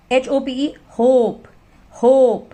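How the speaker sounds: होप drawn out, long ओ; slow letter by letter then होप with a long vowel; long vowel demo. Shown as a demonstration. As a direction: spell it slowly and stretch the ओ in होप. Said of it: "long vowel sound".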